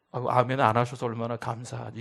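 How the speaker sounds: normal talking voice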